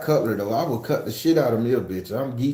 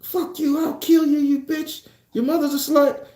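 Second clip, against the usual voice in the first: high mock gamer rant, much higher; imitating cursing gamers. Mimicking online players cursing into headsets, high mock rage. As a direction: squeak out the insults like an angry kid gamer. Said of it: "they be in there just cursing their ass off".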